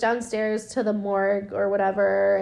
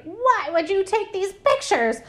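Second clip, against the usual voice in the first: high-pitched mock outrage; mock mad demand; 10 semitones higher than usual. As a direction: demand an answer in hammed-up indignation. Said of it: "are you getting mad".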